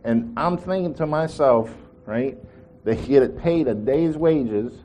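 ordinary delivery

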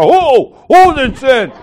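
high, loud mock-alarmed demand. Pastor questioning the tattling churchgoer, mock alarm. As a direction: imitate a scandalized pastor demanding an answer.

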